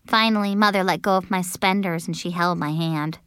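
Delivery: ordinary delivery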